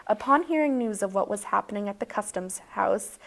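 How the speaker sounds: mock storyteller reading a tall tale; playful bedtime story voice; bedtime story narration. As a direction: spin it like a bedtime story, deadpan.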